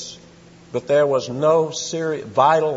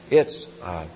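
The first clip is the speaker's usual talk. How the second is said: normal talking voice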